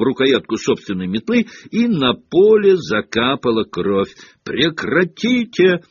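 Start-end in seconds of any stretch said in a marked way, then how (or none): 4.4-5.8 high, drawn-out referee's cry; understated shrill yell; piercing cry. A narrator's reading. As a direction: cry out to halt the foul. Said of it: "пронзительно завопила мадам Трюк".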